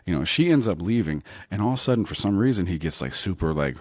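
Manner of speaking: ordinary delivery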